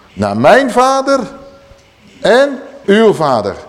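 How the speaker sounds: slow, stressed declaration; solemn emphasis. Acted. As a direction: proclaim it deliberately. Yeshua's words quoted emphatically.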